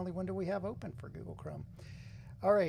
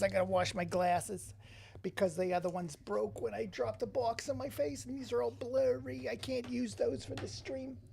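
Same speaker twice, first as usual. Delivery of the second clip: hammed-up [name] accent, climbing higher as it goes; bad [name] accent; 5 semitones higher than usual. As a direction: do a terrible [name] accent, rising in pitch. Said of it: "my terrible [name] accent".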